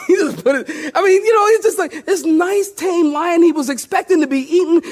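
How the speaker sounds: high, helpless laughing delivery; laughing while talking. Real, not acted. Preacher cracking up over the tame lion, laughing.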